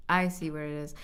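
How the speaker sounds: usual speaking voice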